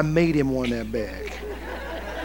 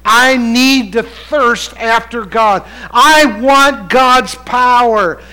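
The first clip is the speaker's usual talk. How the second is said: loud, drawn-out pulpit delivery; raised pitch, weighty slow emphasis; preaching; 6 semitones higher, 6 dB louder, slower than usual. Acted. Preacher reading scripture to congregation, preaching it out.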